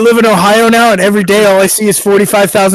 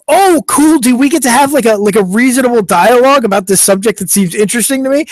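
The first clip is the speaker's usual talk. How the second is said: eager, high-starting retold reaction; hopeful enthusiasm; 4 semitones higher than usual. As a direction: act out naive delight at a chance to chat. Voicing his own hopeful reaction.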